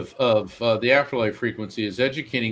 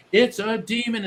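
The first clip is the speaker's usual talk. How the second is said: mocking; 10 semitones higher than usual. Mocking people's first reaction.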